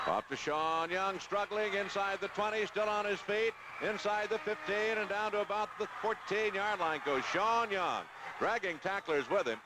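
rising excited call of the run; live play-by-play. Real, not acted. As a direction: call the run as it happens, building.

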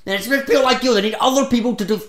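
escalating exasperated outburst; angry rant. Acted. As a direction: rant indignantly, losing your temper. Anger rant until therapist intervenes.

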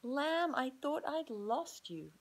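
high, loud, slow relieved cry. Acted. Emma finding lost Lamb, relieved high loud slow cry. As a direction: hug him with your voice. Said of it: "said Emma".

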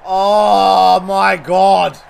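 very slow, loud oh my God; loud slow gasp of amazement; drawn-out disbelief. Real, not acted. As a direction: stretch it out in disbelief. Reacts to a shot.

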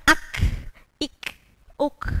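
"ak, ik, uk" ending in a breathy K release; Arabic K example. Shown as a demonstration. Teacher demonstrating final K with air. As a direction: breathe out after each K. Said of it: "Saya contohkan".

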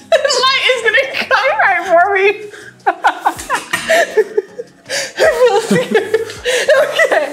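high, giggly mock fright; trying not to laugh. Real, not acted. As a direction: laugh through the words. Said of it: "I'm trying not to laugh".

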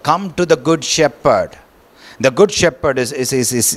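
ordinary talk

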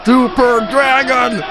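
high, slow, far-off chant of the name; fan yelling. Retold or imitated. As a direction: shout it like a fan across the arena. Imitating the crowd chant.

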